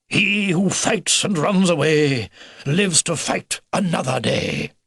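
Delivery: slow, drawn-out, grand recital; declaimed proverb. Acted. Old beaver quoting a saying to Philip, declaiming. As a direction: stretch it out like a rousing motto.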